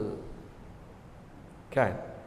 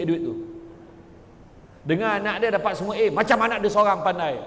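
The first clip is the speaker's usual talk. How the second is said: normal talking voice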